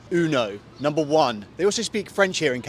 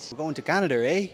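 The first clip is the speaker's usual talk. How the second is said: put-on playful go at a Canadian accent; attempted Canadian accent; faster than usual. Traveller showing off an accent before the flight, mock Canadian accent. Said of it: "that's a good accent".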